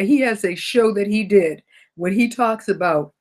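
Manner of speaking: normal talking voice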